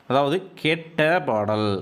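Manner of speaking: slow, each word set apart for the class; slow teaching read. Shown as a demonstration. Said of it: "ஆ உச்சரிப்பு வருதா?".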